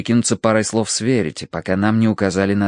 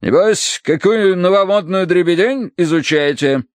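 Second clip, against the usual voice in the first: butting-in colonel; 8 semitones higher than usual. A narrator's reading. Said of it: "вклинился полковник".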